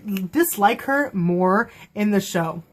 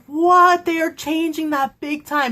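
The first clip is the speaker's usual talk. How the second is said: shocked disbelief; 7 semitones higher than usual. Retold or imitated. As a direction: re-enact a stunned fan's outburst. Re-enacts her first shocked reaction.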